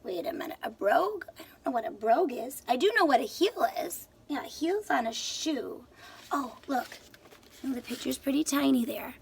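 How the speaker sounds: usual speaking voice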